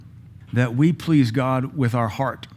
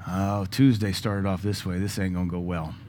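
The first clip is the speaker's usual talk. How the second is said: acted glum foreboding; 5 semitones lower, 6 dB quieter than usual. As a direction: play a kid quietly dreading the day. Voices a child reading dad's mood.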